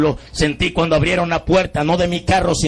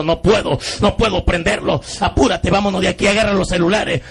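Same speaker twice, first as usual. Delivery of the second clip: panicked urging; 6 semitones higher than usual. Retold or imitated. Driver panics, urging escape.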